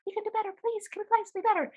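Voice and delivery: impassioned high plea, no longer whispering; bursting out, pleading. Retold or imitated. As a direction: finally speak up and plead for better. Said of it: "really kind of getting loud".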